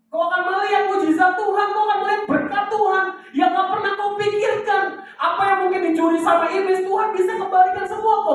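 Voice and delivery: high, sustained exhortation; full-force preaching. Real, not acted. Pastor urges congregation to stand firm, preaching at full force. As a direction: drive each phrase home in a high, ringing preacher's pitch.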